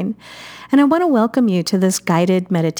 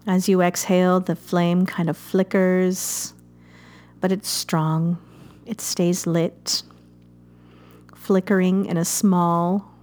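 gentle meditation guide, unhurried; guided meditation. Acted. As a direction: ease the listener into calm breathing. Leads a guided fire meditation.

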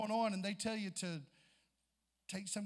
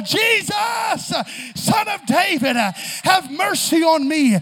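shouted, slow plea; high shouted desperate plea; crying out louder; 9 semitones higher, 19 dB louder, slower than usual. Acted. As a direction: cry even louder so Jesus hears. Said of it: "So I'm gonna cry even the more".

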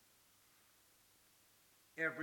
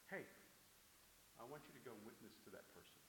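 very soft, low and quick; a hushed nudge from above; gentle divine prompting; 5 semitones lower, 13 dB quieter, faster than usual. Acted. God prompting a believer to witness, softly.

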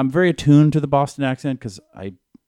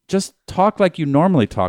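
ordinary talk

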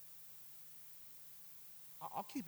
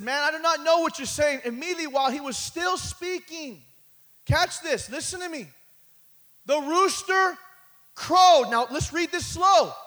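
loud, high, shouted sermon climb; full force preaching; 13 semitones higher, 20 dB louder than usual. Acted. Preacher building to the rooster scene, loud and high. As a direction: preach it out to the rafters.

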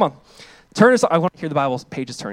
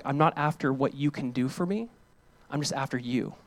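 soft, hushed and much quieter; tender softly; 2 semitones lower, 7 dB quieter than usual. Retold or imitated. Preacher voicing a loved one's soft, tender words. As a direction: let it fall away gently.

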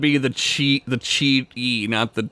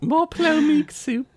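high, slow plea of a starving Victorian orphan; 13 semitones higher than usual. Pretend. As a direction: beg for more soup like a hungry orphan child. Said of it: "He's a Victorian orphan".

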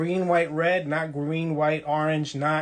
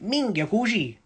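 Sicilian friend; 5 semitones higher, slower than usual. Retold or imitated. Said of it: "and he goes".

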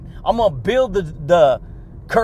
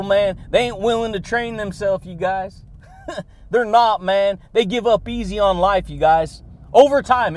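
ordinary talk, nothing marked

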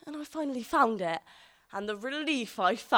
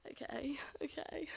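breathy whispered okay, slow and higher; 6 semitones higher, 12 dB quieter than usual. Retold or imitated. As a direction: whisper it like a giggling four-year-old. Little sister bribed, giggly agreement.

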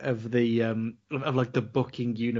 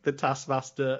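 usual speaking voice

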